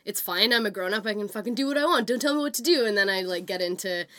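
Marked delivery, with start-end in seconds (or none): none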